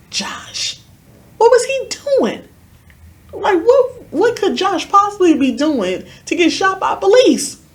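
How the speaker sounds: hushed name, then high, loud disbelief; incredulous shock. Retold or imitated. Asking how a friend got shot by police, incredulous. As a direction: burst into high-pitched, baffled questioning.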